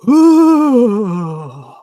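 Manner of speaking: high, loud hooting ghost moan; a cartoony spooky wail, held long; cartoon ghost ooh. Retelling the ghost noise heard with his wife: a mock cartoon-ghost ooh. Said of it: "It sounds like a cartoon ghost".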